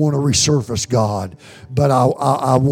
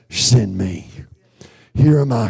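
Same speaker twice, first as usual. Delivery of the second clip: ordinary talk, nothing marked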